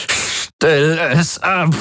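very high, slow wail; drawn-out anguished cry. A narrator's reading. Tormented man's last anguished plea to the Helix, slow and high. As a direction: stretch it into an anguished howl. Tortured man begs noise stop.

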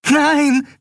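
hoher verzweifelter Ruf; verzweifelter Ausruf. A narrator's reading. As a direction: verzweifelt aufschreien, Stimme schnellt hoch. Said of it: "rief Nelen verzweifelt aus". (Nelen ruft verzweifelt, um die Freundin zu retten)

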